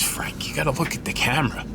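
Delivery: whispered aside. Real, not acted. Aside to self about camera.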